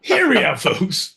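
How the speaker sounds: hammed-up hear me out; mock big announcement. Pitching wild ideas to the folks, loud.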